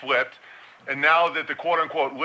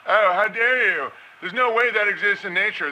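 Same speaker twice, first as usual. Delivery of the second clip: mock outrage; 5 semitones higher than usual. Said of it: "mocking an outraged objector".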